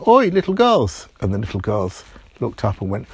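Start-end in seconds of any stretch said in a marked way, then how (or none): 0.1-1.1 higher, louder call across the enclosure. Acted. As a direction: shout over to get their attention. Timmy calls to the girls.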